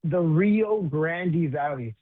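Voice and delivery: drawn-out RIO GRANDE VALLEY, clearly spaced; slow, emphatic repeat. Real, not acted. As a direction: repeat it slowly and clearly for someone who missed it. Repeats the name when asked.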